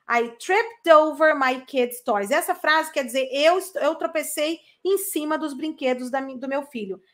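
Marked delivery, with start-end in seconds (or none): 0.1-2.3 slow, clear "tripped over"; slow model sentence. Shown as a demonstration. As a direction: model it slowly. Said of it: "Você fala trip over". (frase modelo lenta)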